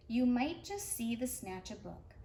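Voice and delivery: ordinary delivery, nothing marked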